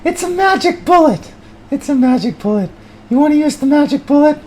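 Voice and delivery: high, whining loser voice; whiny high character. Acted. High whiny voice bemoaning the crowbar. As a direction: whine it like a hopeless failure.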